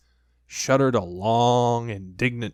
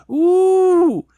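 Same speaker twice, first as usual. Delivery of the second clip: Piglet's high squeal going up; a small animal's startled ooh; high squeaky ooh; 11 dB louder than usual. A narrator's reading. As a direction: squeak it out as he flies up. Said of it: "he said".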